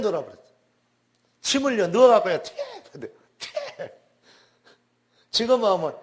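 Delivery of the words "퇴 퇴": sharp unvoiced spit noise; imitated spitting; spitting sound. Possessed girl spitting at pastor.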